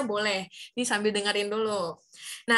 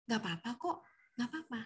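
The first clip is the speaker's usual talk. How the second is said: much softer, strength drained; limp, weak voice; 12 dB quieter, faster than usual. Shown as a demonstration. Teacher showing a powerless voice to kids, weak and fading. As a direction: let the voice go limp and fade. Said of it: "Kalau misalnya [name] lemes".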